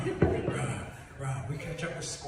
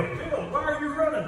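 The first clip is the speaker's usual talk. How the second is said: higher, louder call; calling ahead; 11 semitones higher, 4 dB louder than usual. Acted. Animals ask Bill why: higher call.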